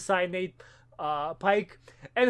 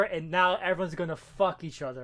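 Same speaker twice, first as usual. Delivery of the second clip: ordinary talk, nothing marked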